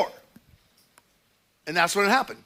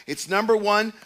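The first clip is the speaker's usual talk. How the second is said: usual speaking voice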